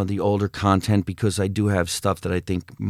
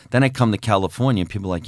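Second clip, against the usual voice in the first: ordinary delivery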